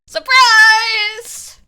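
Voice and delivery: high, loud gleeful surprise reveal; excited exclaiming. Real, not acted. Streamer springs the Canadian judge on Nick, loud excited exclaiming.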